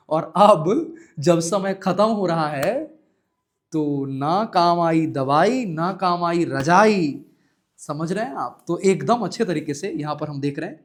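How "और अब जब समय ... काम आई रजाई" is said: slow, emphatic rhyming lament; mock-tragic slow chant; dramatic slow recital. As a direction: drag out the rhyme like a doom verse.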